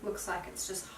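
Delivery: hushed, half-whispered tutorial voice; soft-spoken. Real, not acted. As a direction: talk softly while you work. Soft talk while styling hair.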